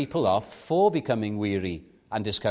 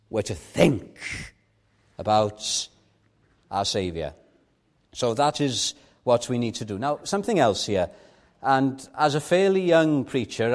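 usual speaking voice

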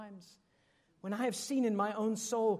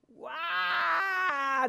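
higher, louder mock toddler wail; child's scream; 9 semitones higher, 5 dB louder than usual. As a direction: imitate a toddler bursting into a wail. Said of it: "The screams would start".

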